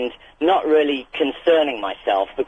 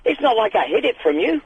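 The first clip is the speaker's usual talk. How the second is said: high, outraged protest; like you can't believe the cheek; indignant disbelief; 11 semitones higher than usual. Retold or imitated. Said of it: "And I'm like".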